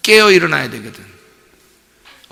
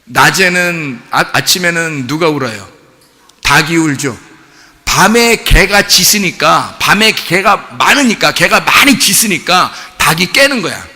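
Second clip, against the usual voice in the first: ordinary delivery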